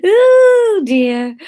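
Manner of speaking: high, loud drawn-out oh; exasperated wail. Real, not acted. Narrator who has lost the accents, high wail of dismay. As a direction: wail it in comic despair. Despairing noise over failed accents.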